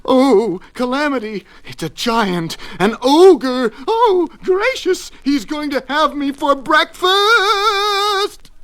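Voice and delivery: high, loud hysterical wailing; panicked screaming. A narrator's reading. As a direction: wail in comic terror, stretching the last word. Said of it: "he screamed".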